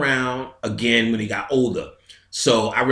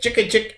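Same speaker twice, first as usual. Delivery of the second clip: scratch noises; 10 semitones higher than usual. Retold or imitated. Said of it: "to go jick".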